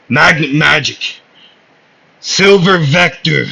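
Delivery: loud, high battle cry; spell-casting shout. Acted. Asta unleashing his magnet magic, shouted battle cry. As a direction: call out each attack with force.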